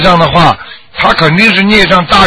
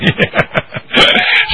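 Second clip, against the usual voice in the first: soft breathy chuckle; 5 dB quieter than usual. Real, not acted. Host chuckles at caller.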